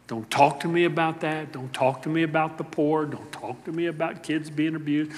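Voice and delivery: low, soft, dismissive mutter; apathetic person. Retold or imitated. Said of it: "as practiced by some in our world today".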